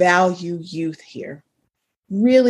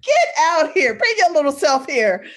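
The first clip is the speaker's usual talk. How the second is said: laughing call; 6 semitones higher than usual. Retold or imitated. Mom calling daughter, laughing.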